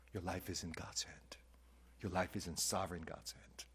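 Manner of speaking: soft unvoiced whisper of comfort; whispered reassurance. Pastor voicing Jesus comforting the fearful, whispered. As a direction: whisper the promise gently.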